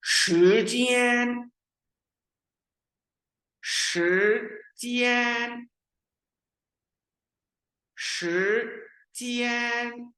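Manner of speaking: slow, high शिजियान with rising then level tone, stretched; teacher drilling second tone into first tone; Mandarin tone drill. Shown as a demonstration. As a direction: model it for students to repeat. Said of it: "kindly repeat after me".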